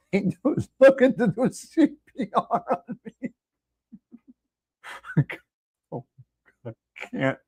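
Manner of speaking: laughing through the words. Real, not acted. Host laughing to his co-hosts about his own outburst. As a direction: giggle it out after the rant.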